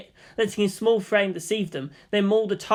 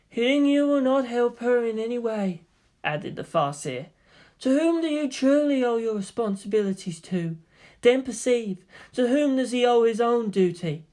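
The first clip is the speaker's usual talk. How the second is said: higher, measured mystic voice; eldar Farseer; 5 semitones higher than usual. A narrator's reading. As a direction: speak as a serene seer. The Farseer speaks.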